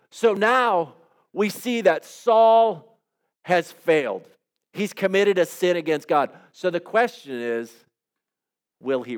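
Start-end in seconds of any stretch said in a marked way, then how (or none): none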